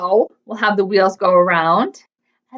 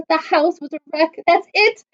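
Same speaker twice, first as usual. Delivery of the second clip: wailing; 8 semitones higher than usual. A narrator's reading. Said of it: "wailed Bob".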